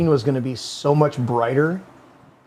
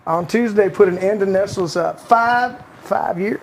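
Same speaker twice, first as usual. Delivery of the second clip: Bill Clinton voice; 2 semitones higher than usual. Retold or imitated. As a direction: read the article as a mild Clinton impression with a Southern accent. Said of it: "in the Clinton voice".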